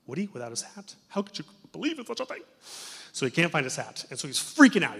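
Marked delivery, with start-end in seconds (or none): 0.1-2.4 mock disbelief. Mock disbelief about the hat.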